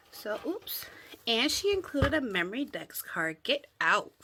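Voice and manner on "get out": ordinary talk, nothing marked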